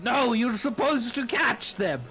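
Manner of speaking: high, acted yell of protest. Player voicing his character yelling to a friend, high protest. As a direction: call it out high without full volume. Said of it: "he'll yell out".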